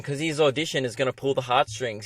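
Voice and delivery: normal talking voice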